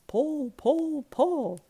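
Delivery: plain reading voice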